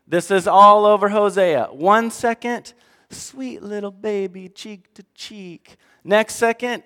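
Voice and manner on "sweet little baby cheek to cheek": put-on soft, slow baby talk; softly slowed mock coo to a baby; mock tender cooing. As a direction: coo it like to an infant. Contrasting tender God with fierce.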